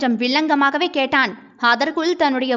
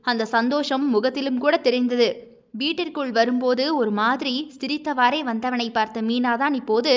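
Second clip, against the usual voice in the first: plain reading voice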